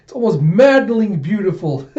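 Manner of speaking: high, loud swell of awe; worked-up, enraptured exclamation; rapturous villain. Acted. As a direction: savor the beauty with mad delight. Villain's monologue in manga retelling.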